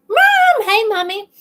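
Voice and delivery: high, loud call to mom; excited calling out. Real, not acted.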